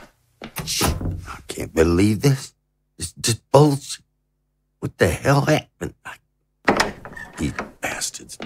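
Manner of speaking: seething under his breath; fuming mutter. Acted. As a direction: grumble it to yourself, half whispered at the end. Alone, fuming after being shut in.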